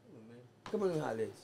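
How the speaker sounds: ordinary talk, nothing marked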